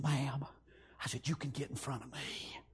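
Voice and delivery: very soft, low courtroom whisper; hushed whisper. Retold or imitated. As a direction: whisper it discreetly to the woman beside you. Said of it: "I whispered to her".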